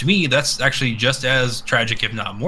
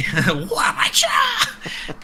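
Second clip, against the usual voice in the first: high squealing laugh. Real, not acted.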